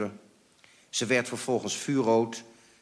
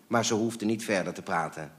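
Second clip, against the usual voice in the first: ordinary delivery, nothing marked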